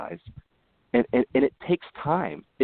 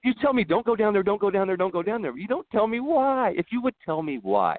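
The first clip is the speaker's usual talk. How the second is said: put-on exasperated kid; 11 semitones higher than usual. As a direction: whine it like a frustrated boy arguing with his dad. Kid arguing with his dad.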